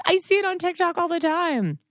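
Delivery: very high, squeaky tween voice; tween daughter voice. Retold or imitated. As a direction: mimic a horrified eleven-year-old. Mom imitating daughter.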